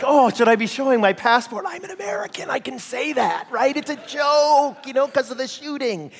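nervous laugh-it-off voice; awkward backpedalling. Retold or imitated. Pastor trying to smooth things over with the cashier, sheepish. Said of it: "I was like".

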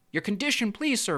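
high, eager plea; begging. A narrator's reading. Tiny fish asking the pelican his terms, begging. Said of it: "begged the tiny fish".

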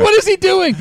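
high-pitched mock bewilderment; baffled outcry. Viewer hearing a bad accent, baffled outcry.

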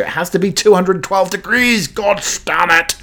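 high, exasperated outburst ending in a curse; mock exasperation. Host mocking the TikTok tea method, high exasperated outburst. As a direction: blurt it out like a fed-up tea snob. Acted exasperated American viewer.

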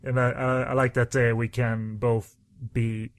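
normal talking voice

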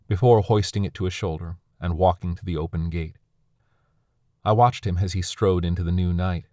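plain reading voice

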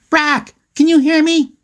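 put-on very high kid's call across the water; acted high-pitched yell. A narrator's reading. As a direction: holler to be heard, pitched right up. Matt calling across canoes.

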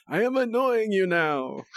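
high, slowly drawn-out puppet voice; slow cartoony Muppet teasing; Muppet impression. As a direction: stretch it out like a taunting Muppet. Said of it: "Sesame Street Muppets impersonating other Muppets".

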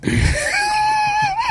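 high shrieking laugh; squealing laugh. Real, not acted. Friend cracks up at the joke, squealing laugh. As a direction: burst into a shrill laugh.

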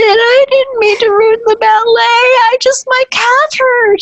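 put-on very high, childlike pleading; scared little voice. Dancer confessing to Pat Miri from under the table, tiny scared voice. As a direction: plead like a frightened child caught hiding. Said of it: "I said".

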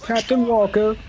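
high, loud announcer call; hype announcer. Pretend. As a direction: introduce him like a showman. Hyping up a friend.

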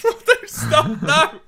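high, loud, laughing no; laughing protest. Real, not acted. Host reacting to his co-host's whisper, laughing protest. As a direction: squeal it out through laughter.

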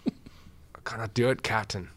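slow, despairing engineer; Scotty impression. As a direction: drag it out like a defeated Scotty. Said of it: "And then he's like".

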